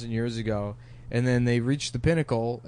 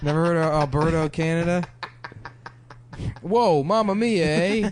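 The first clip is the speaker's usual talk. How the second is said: Italian-Canadian caricature; 3 semitones higher than usual. Pretend. Podcaster playing a proud Italian from Canada, hammed-up Italian accent.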